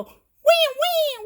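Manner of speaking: high, loud toy wail; pretend crying. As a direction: squeal a make-believe little cry. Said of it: "Bear go".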